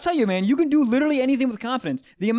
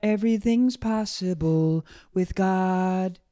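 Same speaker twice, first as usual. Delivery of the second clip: slow, drawn-out preacher voice, hamming up the faith; a slow preacher proclaiming to the whole flock; mock preacher; much slower than usual.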